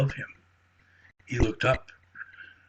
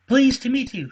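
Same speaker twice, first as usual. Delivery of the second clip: high, loud squeak of a greeting; eager squeaky squirrel; 14 semitones higher, 8 dB louder than usual. Acted. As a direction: pipe it out, thrilled to meet someone. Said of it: "Squeaky nodded".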